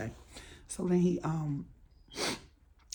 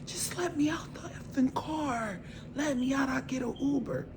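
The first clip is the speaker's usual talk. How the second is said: higher, hissed whisper, barely holding in rage; furious hissed whisper; 8 semitones higher than usual. Retold or imitated. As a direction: hiss it out, mad enough to jump out of the car. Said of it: "So I'm so mad".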